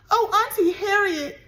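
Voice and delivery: high, loud tearful cry. A narrator's reading. Annabelle calling Auntie Harriet, sobbing high. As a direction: wail for her aunt. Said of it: "she cried".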